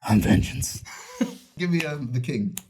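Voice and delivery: mock brooding hero line; Robert Pattinson impression. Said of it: "Can I hear a Robert Pattinson impression?".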